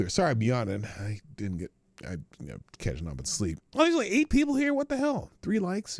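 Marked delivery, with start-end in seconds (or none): 3.7-6.0 surprised disbelief. Real, not acted. Few viewers in the stream.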